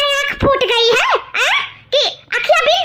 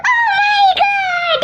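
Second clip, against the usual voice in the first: long, drawn-out high wails; wailing; 7 semitones higher, much slower than usual. Acted. Mistreated girl wailing alone after a beating, sobbing. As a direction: howl out the grief slowly.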